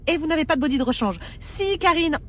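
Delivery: usual speaking voice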